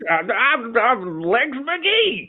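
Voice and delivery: very high, loud prospector voice; stretched-out southern old-timer squawk. Acted. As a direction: crow like a crazy old gold prospector. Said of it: "southern prospector voice".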